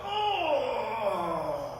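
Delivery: big falling "oh" of a yawn; long loud waking groan, sliding down; hammed-up waking-up wail. Rabbit wakes up.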